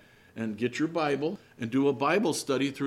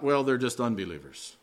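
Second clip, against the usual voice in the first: usual speaking voice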